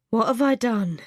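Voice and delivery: weary, tired voice. A narrator's reading. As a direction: sound worn out. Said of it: "he asks in a tired voice".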